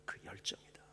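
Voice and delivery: soft, near-whispered exhortation; hushed preaching. Acted. Pastor inviting the congregation to look to the cross, whispered. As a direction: draw them in quietly to the cross.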